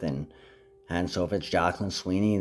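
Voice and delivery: usual speaking voice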